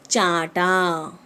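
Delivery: "चाटा" drawn out slowly with a falling tone; chaTa for slap. Shown as a demonstration. Teacher demonstrates "चाटा" slowly to pupils. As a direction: stretch "चाटा" long and let it fall. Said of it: "और चाटा मतलब to slap".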